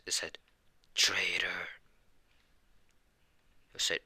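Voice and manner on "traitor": hissed whisper of traitor; whispered accusation. Acted. Demon accuses his foe, whispered. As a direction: breathe the word like a curse.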